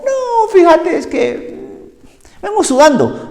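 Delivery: high, mocking sarcastic reply; sarcastic answer. Soaked man answering his family's silly question, sarcastic and high. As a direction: toss back the obvious with a sneer. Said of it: "dan ganas de contestar así".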